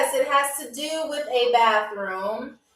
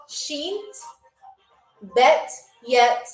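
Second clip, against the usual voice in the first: long gaps between the words; word-by-word demonstration for learners; slow and carefully separated; much slower than usual. Shown as a demonstration. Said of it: "Very, very slow".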